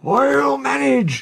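very high, drawn-out wheeze; slow, gasping, effortful cry; wheezing. A narrator's reading. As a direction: strain it out with no breath left. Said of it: "he wheezed".

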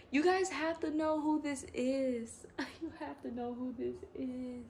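high-pitched excited urging; high and giddy, breaking into a laugh; thrilled insistence. Real, not acted. Thrilled on recognising the doll.

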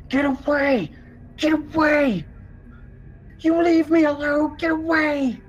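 high, panicked screaming from behind a door; screaming man. Acted. Man inside the cabin screams at the intruders, high panicked yelling. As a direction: yell it high and wild to drive them off. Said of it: "you hear someone inside yelling and screaming".

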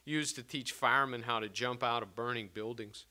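measured storytelling read aloud; story narration. Acted. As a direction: read it like a literary reading. Author reads his fiction aloud.

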